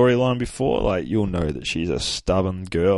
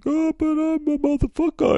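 tiny squeaky mock-pitiful voice; hammed-up falsetto, playing doe-eyed; doe-eyed whine; 3 dB louder than usual. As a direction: squeak it out very high. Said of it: "looking at him all doe-eyed".

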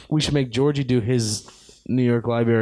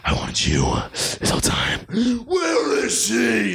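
Batman voice; 6 semitones higher than usual. Pretend. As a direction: growl the lines like a brooding Dark Knight, then explode. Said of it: "do the Batman voice".

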